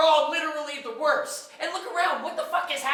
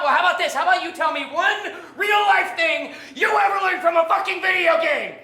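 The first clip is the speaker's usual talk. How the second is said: raised, heated demand; angry challenge; 3 semitones higher, 3 dB louder than usual. Acted. Ranting at someone going back to daddy, angry challenge. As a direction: build to an indignant shout. Heated quarrel over a child.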